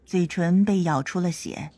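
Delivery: usual speaking voice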